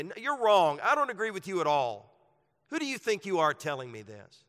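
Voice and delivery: loud, high, indignant push-back; angry defiant brother refusing correction; defiant angry retort. Acted. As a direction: snap back like you've been insulted. Acted defiant brother quarrelling back.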